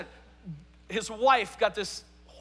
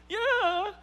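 very high, rising tearful squeak; mock bawling; 12 semitones higher than usual. Crying girl confirming to youth leader, mock wail. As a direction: whimper it like a spoiled teen. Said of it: "she's just bawling".

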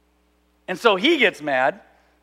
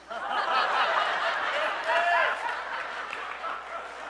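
long, high, airy laughing; breathy laugh; 6 dB quieter than usual. Real, not acted. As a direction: let the laugh run out. Laughs at his own joke.

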